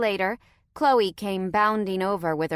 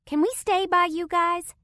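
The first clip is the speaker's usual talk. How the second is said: put-on much higher eager little-girl voice; hopeful child; 12 semitones higher, 5 dB louder than usual. A narrator's reading. Little girl asking the strangers to stay nearby, hopeful. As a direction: plead sweetly to stay close. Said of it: "looking up at us expectantly".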